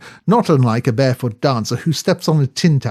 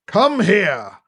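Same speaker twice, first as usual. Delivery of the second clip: bellowed command; 6 semitones higher than usual. A narrator's reading. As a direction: bellow it across the room. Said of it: "bellowed Sir Roderick".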